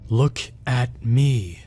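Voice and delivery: slow growl, deep breath between each word; breathy growl. Acted. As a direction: growl it out, gasping for air between words. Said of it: "it growled in my ear again, taking deep breaths in between each word".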